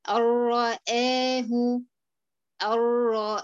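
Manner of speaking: "الرآه" with the noon merged into the ra, no nasal hum; idgham without ghunna. Shown as a demonstration. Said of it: "المثال". ("الرآه" بإدغام كامل بلا غنة)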